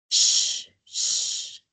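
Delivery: voiceless sh sound held out; hush-like sh, no voice; whispered sh hiss. Shown as a demonstration. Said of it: "I will show each phonetic symbol".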